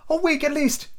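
put-on a boy trying to object; high young lad's voice; young Jim. Jim answering.

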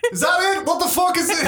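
very high, outraged outburst; irate cave woman. Acted. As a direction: scoff at them in an angry character voice. Fiona scoffs at the visitors.

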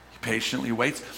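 usual speaking voice

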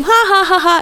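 high, mock classroom laughter; spoken ha-ha of jeering kids; imitating kids laughing. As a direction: put on a high group laugh. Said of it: "the kids are all laughing".

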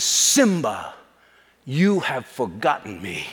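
slow, solemn booming-father voice; lion king father calling his son to account; Mufasa. Retold or imitated. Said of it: "his dad has that booming voice".

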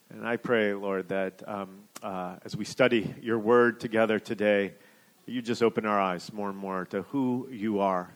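low, quiet extemporaneous prayer; hushed pastor's prayer before preaching; spoken prayer. Real, not acted. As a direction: pray softly and low, with small hesitations. Leads an opening prayer.

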